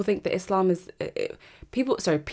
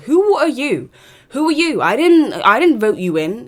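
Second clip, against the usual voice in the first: loud, high, demanding who they are; indignant shout; 6 semitones higher, 10 dB louder than usual. Acted. Challenging unelected judges with an indignant shout. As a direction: call out the judges loudly.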